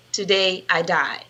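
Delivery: ordinary delivery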